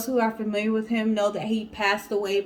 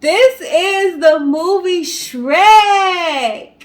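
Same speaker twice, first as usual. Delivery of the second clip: slow, loud and higher, drawing out each word; drawn-out announcement; 8 semitones higher, 10 dB louder, much slower than usual. Acted. Host revealing the movie to the viewers, drawn-out and loud.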